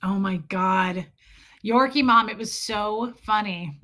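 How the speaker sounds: ordinary talk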